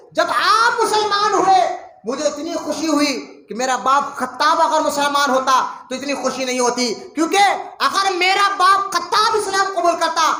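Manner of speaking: tearful declaration. Acted. Umar tearfully declaring love.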